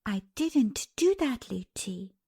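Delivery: plain reading voice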